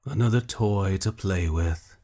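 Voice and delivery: slow, lightly sneering witch hunter. A narrator's reading. Witch hunter taunting his captive, slow sneer. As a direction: drawl it out with contempt. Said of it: "Morald sneered".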